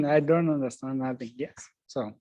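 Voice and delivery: normal talking voice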